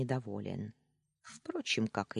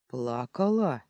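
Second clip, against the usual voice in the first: restrained growl through gritted teeth; 5 dB louder, slower than usual. A narrator's reading. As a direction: growl it out with the jaw held tight. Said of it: "сквозь зубы рыкнул он".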